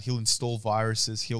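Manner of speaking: ordinary talk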